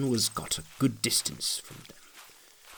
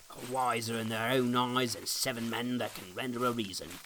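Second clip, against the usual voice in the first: a light sneer at the crazed fellow; scornful Obstinate; 11 dB quieter than usual. A narrator's reading.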